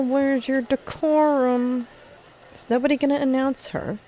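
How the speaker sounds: usual speaking voice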